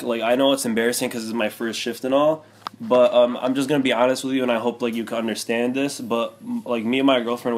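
normal talking voice

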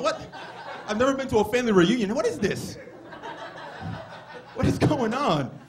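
high, loud comic protest; indignant disbelief. Retold or imitated. Son learning the woman is his aunt, hammed-up disbelief. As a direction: exclaim like a baffled, wronged teenager.

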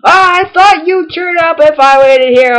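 high, slow, put-on rival voice; overacted villain reading game text; hammed-up rival. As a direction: declaim it high and drawn out. Reads rival's game dialogue aloud, hammed up.

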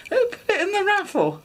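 high-pitched, laughing mock outrage; squeaky acted-out disbelief; scandalised demand. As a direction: imitate an aghast husband wanting a culprit. Husband's scandalised question.

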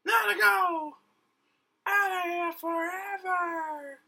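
high, loud escaping cry; high excited character voice. Acted. Character fleeing the host, high and excited. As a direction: yell it while running off.

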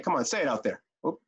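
ordinary talk

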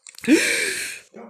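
sharp airy gasp of fright; startled gasp. Real, not acted. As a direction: gasp in sudden alarm. Nearly falls into a hole.

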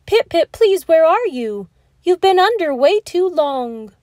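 put-on a mother suddenly afraid for her child; cries out in fear. Mommy calls for Pip-Pip under the water, alarmed cry. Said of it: "Then she cried out".

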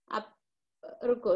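ordinary delivery

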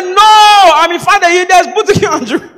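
high, loud, emphatic correction; mock insistence. Acted insistent correction on location.

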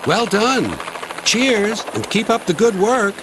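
high, hearty helicopter sign-off; jaunty helicopter farewell. A narrator's reading. Helicopter praises the diesel after the rescue, high and jaunty. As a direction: call it out high and breezy.

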